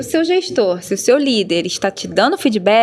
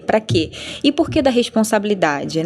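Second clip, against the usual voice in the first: usual speaking voice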